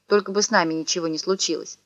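usual speaking voice

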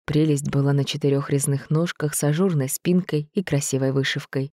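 ordinary delivery, nothing marked

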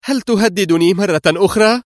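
high, indignant challenge; sharp outcry. A narrator's reading. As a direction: cry out sharply, affronted. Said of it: "صاحت في حدة". (صيحة حادة مستنكرة)

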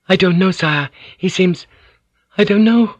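high, hesitant woman's reply; trailing off uncertainly. Acted. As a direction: falter between phrases, unsure of the answer. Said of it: "Her voice trailed off".

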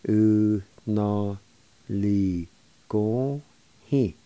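"U na li go hi." in long, separate syllables. Shown as a demonstration. Teacher introducing "U na li go hi." to learners, syllable by syllable. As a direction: pause after each syllable of "U na li go hi.". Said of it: "It's pronounced like this".

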